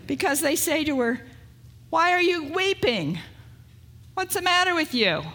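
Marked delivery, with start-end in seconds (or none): 1.9-5.2 high, loud and impatient, with a mocking edge; a preacher voicing unsympathetic angels who scoff at Mary's tears. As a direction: scold her like brusque, uncaring angels. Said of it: "these angels are not very sympathetic".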